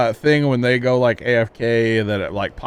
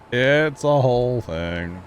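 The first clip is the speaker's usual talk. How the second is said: drawn out; much slower than usual. Pretend. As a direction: stretch out every word playfully. Repeats own catchphrase, stretched out.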